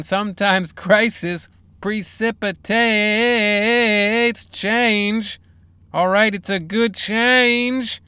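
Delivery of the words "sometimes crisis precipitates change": slow dramatic reread. Shown as a demonstration. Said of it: "Let's hear that again".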